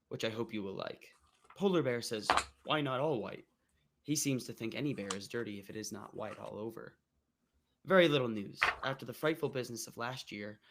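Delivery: ordinary talk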